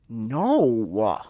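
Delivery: loud, indignant no; offended no. Retold or imitated. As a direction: shout it as if insulted. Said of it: "really fucking offended".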